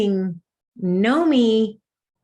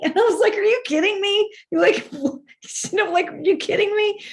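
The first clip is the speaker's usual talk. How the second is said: amused disbelief; 10 semitones higher than usual. Retold or imitated. Retelling surprise with laughter.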